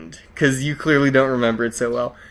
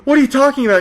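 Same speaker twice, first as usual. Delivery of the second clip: high-pitched, alarmed question; retold panic; 10 semitones higher, 3 dB louder, much faster than usual. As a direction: act out panicked disbelief, pitched way up. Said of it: "actually looking outside panic 'cause you sound so serious".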